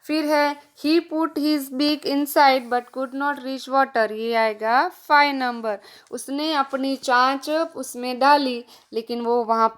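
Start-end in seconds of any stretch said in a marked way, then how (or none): 0.9-4.1 very slow, word-by-word English reading. Shown as a demonstration. Teacher reads the worksheet sentence to children, very slow and deliberate. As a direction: spell it out slowly for the kids.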